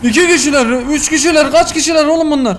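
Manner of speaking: alarmed exclaiming. Real, not acted. More enemies appear in game.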